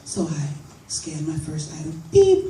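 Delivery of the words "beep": a sharp high beep, loud; mock scanner beep. Comic to the audience, mimicking the scanner's high beep. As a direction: chirp it like a checkout scanner.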